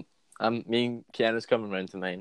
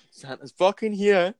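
put-on high, slow, wide-eyed child voice; awed child; 9 semitones higher, 4 dB louder than usual. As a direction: marvel like a little kid. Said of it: "was like".

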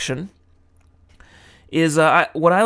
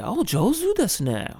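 polite Japanese compliment; 9 semitones higher, 4 dB quieter than usual. Retold or imitated. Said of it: "they'll say".

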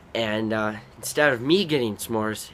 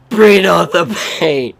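defiant; 6 semitones higher, 13 dB louder than usual. Retold or imitated. Defiant taunt.

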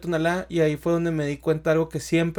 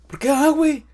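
mock exasperation; 10 semitones higher, 3 dB louder than usual. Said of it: "y yo les decía".

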